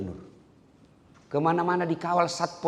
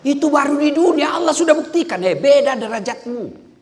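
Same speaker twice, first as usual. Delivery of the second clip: much higher, much louder exhortation; full-force preaching; 13 semitones higher, 8 dB louder than usual. Acted. Preacher rouses the audience about knowledge. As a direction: drive it home to the crowd.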